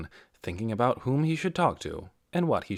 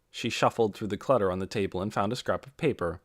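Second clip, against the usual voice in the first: usual speaking voice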